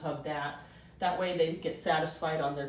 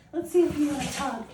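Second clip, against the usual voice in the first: puppy talk; 8 semitones higher, 4 dB louder than usual. Real, not acted. Inviting puppy to tug.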